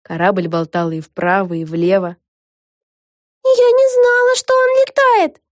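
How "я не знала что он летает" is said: very high, loud, alarmed cry; exclaiming. A narrator's reading. Vega clings to the rail of the flying ship, exclaiming. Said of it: "воскликнула она".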